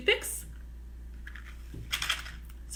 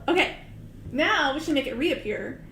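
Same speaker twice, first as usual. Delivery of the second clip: ordinary talk, nothing marked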